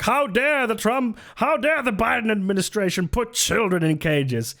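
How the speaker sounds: high mock outrage, how dare; mock outraged Miller. Mocking Miller on Fox, high fake outrage. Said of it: "Stephen Miller now being like".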